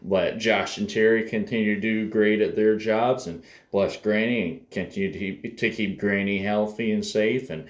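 quiet, earnest spoken prayer. Real, not acted. Grandson praying for family before his drive, quiet and earnest. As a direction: pray sincerely for the family.

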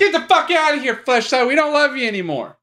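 high, loud yell, playing outraged; hammed-up furious send-off; mock-angry shout. Mock-angry outburst at the sponsor.

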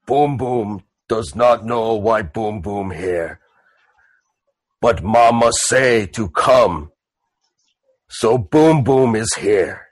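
slow, ponderous half-orc; Boom Boom. Acted. Half-orc explains himself to the mourning family, ponderous. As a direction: speak slowly in simple, separated chunks. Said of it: "And he goes".

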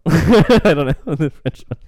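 amused laugh while talking. Real, not acted. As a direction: giggle it out at yourself. Laughs at own French attempt.

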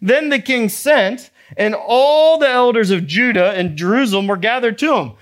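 normal talking voice

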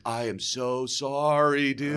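slow, soft mock apology; hushed, drawn-out regret, played for comedy; guilty apology. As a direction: imitate a sheepish friend, drawing it out. Acting friend's guilty apology.

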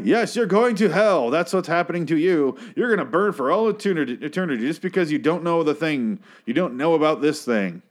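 high-pitched, emphatic zealot; mock damnation rant. Acted stranger warning seatmate of hell, high mock-preachy rant. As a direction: hammer home the threat of eternity.

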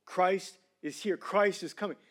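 normal talking voice

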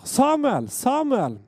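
high drawn call of a name; summoning call, falling at the end. Acted. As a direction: call him from afar, pitched well up. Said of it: "ropte nå som før".

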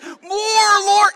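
high, shouted plea in mock frenzy; frenzied cry. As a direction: imitate an ecstatic worshipper crying out. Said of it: "She's like".

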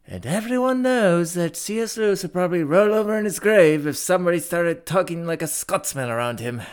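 high, loud, hammed-up Scottish accent; attempted Scottish accent. Co-host trying Irish and landing on Scottish, comic Scottish accent. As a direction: put on a comic Scots brogue, pitched well up. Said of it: "I wanna try an Irish accent, but I always mix that up with my Scottish accent".

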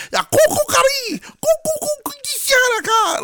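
very high, loud rooster crow; rooster noise. Retold or imitated. Doing the uncle's rooster for dad, high crow. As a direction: screech it like a rooster. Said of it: "I'd make different noises".

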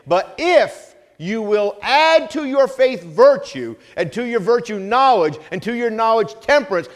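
loud, high-pitched preaching, building; preaching at full force. Acted. Pastor exhorting church to love, loud preaching. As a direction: pastor preaching hard to the congregation.

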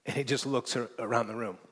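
ordinary talk

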